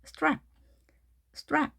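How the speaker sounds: 스트랩 run together as one syllable, English strap; quick, one sound. Shown as a demonstration. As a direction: say 스트랩 fast with no vowels between s, t, r. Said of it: "하나의 소리인 것처럼 빠르게".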